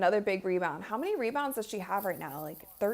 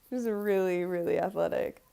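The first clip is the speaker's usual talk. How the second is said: ordinary talk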